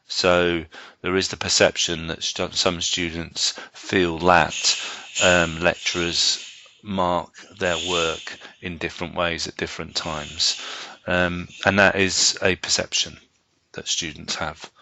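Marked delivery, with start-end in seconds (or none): none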